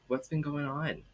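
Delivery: ordinary talk, nothing marked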